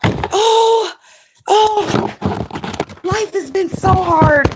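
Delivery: very high, exaggerated pitying coo; mock sympathy. Mocking herself fussing over someone's hard life, mock-pitying. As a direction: gush with overdone pity, stretched out. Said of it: "Instead of saying".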